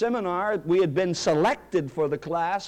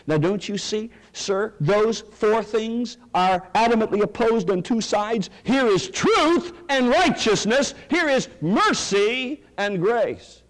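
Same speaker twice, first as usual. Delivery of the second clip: raised, slowing pulpit emphasis; fervent preaching; 5 semitones higher, slower than usual. Acted. Preacher addressing a listener as sir, fervent preaching. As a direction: drive home the two sides.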